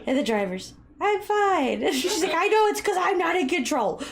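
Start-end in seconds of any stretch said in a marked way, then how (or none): 1.0-1.8 high-pitched mock protest; insistent teen driver. Friend imitating a teenage driver, high mock protest. 2.3-4.0 high, loud mock fretting; imitated tense reply. Friend retelling a mother-daughter driving lesson, high mock fretting.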